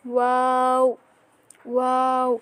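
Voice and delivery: ওয়াও with lips rounded at start and end; Arabic letter demo. Shown as a demonstration. Teacher showing students how to say ওয়াও. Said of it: "শুরুতে গোল, আবার শেষেও গোল".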